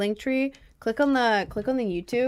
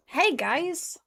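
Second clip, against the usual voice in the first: silly greeting; 8 semitones higher than usual. Pretend. Greeting in the new hat.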